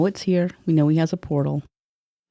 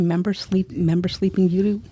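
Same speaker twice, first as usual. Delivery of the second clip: ordinary talk, nothing marked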